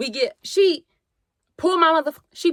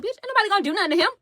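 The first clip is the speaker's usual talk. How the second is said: fast irritated retort; 8 semitones higher, much faster than usual. Retold or imitated. Yelling back at the mother.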